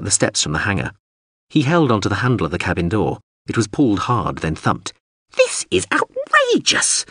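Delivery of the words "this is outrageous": very high, drawn-out drone protest; distant tinny wail. Acted. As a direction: wail slowly in a tiny outraged voice. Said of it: "a distant tinny voice wailed".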